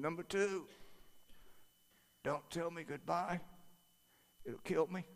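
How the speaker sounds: a weak man after heart surgery, barely there; dad's little voice. Retold or imitated. Frail father speaking from hospital bed, tiny soft voice. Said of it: "he had this little voice".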